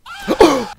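high loud frightened gasp; shrieking gasp. Real, not acted. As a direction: gasp out in sudden fear. Jump scare.

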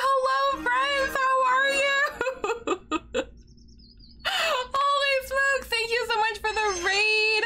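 shrieking with delighted laughter; thrilled squeal. Real, not acted. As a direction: burst out in giddy disbelief at the raid. Reacting to a surprise raid.

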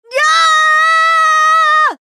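long high shout; full-throated yell; battle yell. Acted. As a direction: let out a huge drawn-out battle cry.

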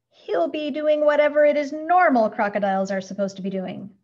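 loud, curt, nasty retort; snapping. A narrator's reading. Mister Grumps to Missus Prim about the zoo, snapped. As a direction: snap back without any sympathy. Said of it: "snapped Mister Grumps".

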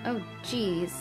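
ordinary delivery

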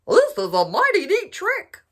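high, loud delighted voice; happy dog. A narrator's reading. Dog delighted watching the duck ride, high and loud. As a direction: cheer at the trick. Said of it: "They're so happy".

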